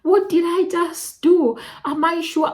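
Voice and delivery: high, rising panic in the head; panicked inner voice. Retold or imitated. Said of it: "and I'm like".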